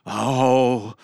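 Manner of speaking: long drawn-out doggy moan. A narrator's reading. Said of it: "moaned the dog".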